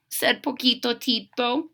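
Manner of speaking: usual speaking voice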